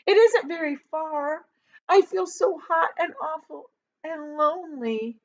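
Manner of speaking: high, teary begging; crying child's plea; tearful pleading boy. A narrator's reading. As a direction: plead on the edge of tears. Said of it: "James cried out".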